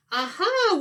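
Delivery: high, loud triumphant shout. Acted. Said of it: "we all shouted".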